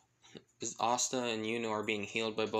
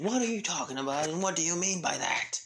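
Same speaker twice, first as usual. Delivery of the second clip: high-pitched, affronted protest; mock-offended outburst, pitch swooping up; indignant; 6 semitones higher, 3 dB louder than usual. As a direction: fire back as if offended. Elijah objects to friend's remark.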